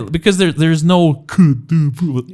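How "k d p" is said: "k, d, p" each popped out alone, higher; isolated hard consonants. Shown as a demonstration. Showing sounds Russian lacks, "k, d, p" popped out hard. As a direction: fire off "k, d, p" as hard separate stops. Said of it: "these sounds".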